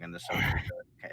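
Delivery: usual speaking voice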